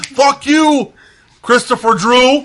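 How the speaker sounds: high, loud hammed-up curse; mock angry yell. Cursing the singer for laughs, a loud mock yell. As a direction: yell it at him like a fake rage fit. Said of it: "That's why I said".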